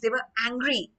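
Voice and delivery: normal talking voice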